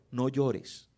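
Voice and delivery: soft, gentle no llores, said quietly and low; la versión suave de Jesús: no llores, en voz baja y tierna; gentle soft take. Shown as a demonstration. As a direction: hush her gently. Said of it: "Habló con autoridad".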